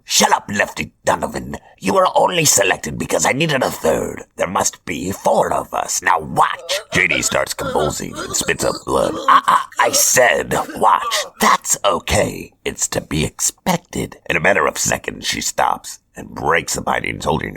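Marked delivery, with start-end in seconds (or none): 0.0-6.8 higher, theatrical villain voice; Hobgoblin voice. Acted. Hobgoblin announces his chosen apprentices, smug villain voice. 9.3-14.2 higher, theatrical villain voice; Hobgoblin voice. Acted. Hobgoblin announces his chosen apprentices, smug villain voice. Hobgoblin watching Jenny convulse.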